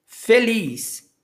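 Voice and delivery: usual speaking voice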